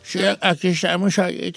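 put-on low, soft old man voice; old [name] voice. Shown as a demonstration. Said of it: "Habla como [name] de viejito".

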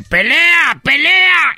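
very high loud yell of "pelea"; shouting fight. Retold or imitated. Calling out a fight to the crowd, yelling high and loud. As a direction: yell "pelea" like a brawl broke out. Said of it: "El otro día dije".

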